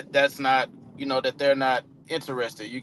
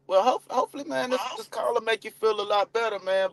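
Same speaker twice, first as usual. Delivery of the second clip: much higher, bright and coaxing; energy boost; 6 semitones higher than usual. Shown as a demonstration. Caller lifting a flat lead's mood, upbeat. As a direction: lift his mood with cheer. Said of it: "if that energy die".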